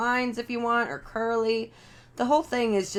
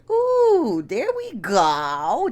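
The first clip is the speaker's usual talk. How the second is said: high delighted ooh at the light; a bright little gasp of approval; pleased ooh; 4 semitones higher, 3 dB louder, much slower than usual. Real, not acted. To viewers, pleased the lighting works: high delighted ooh.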